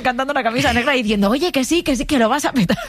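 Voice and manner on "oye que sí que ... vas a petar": high-pitched, excited reveller, half laughing; mock party enthusiasm. Guest imitating partygoers won over by the song, excited. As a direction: insist in a high, giddy voice.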